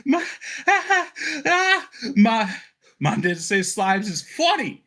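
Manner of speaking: put-on high-pitched giggly kid, gasping out words; child talking between laughs. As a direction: squeeze the words out through helpless giggles. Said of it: "she starts saying between laughs".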